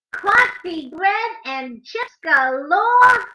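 animated picture-book reading; reading to children. A narrator's reading. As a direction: read the rhymes to little kids, bouncing on the beat.

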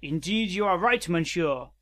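mock French detective; hammy French-accented detective. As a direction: concede the point in a French accent. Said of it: "Robo Kitty has a French accent".

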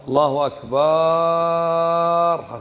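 "الله أكبر" with "أكبر" stretched to "akbaaaar"; muezzins' long vowel. Shown as a demonstration. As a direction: hold the last vowel of "أكبر" long, like a call to prayer. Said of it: "الأكثر إيش يقولون".

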